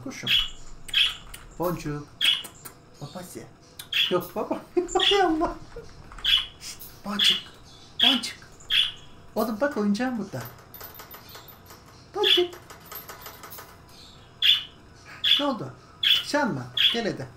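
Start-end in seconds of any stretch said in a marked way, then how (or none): 4.5-5.6 yüksekten gülerek hay Allah; tiz bir gülüşle şaşır; şaşkın kahkaha. Real, not acted. Kuş bağırınca gülüp şaşırma. 6.6-17.3 tiz, sevecen evcil hayvan sesi; kuşuna tatlı tatlı seslen; kuşa seslenme. Real, not acted.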